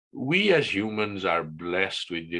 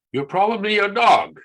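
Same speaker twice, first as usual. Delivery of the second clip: punchline; 11 semitones higher, 10 dB louder than usual. Acted. Punchline after serious build-up.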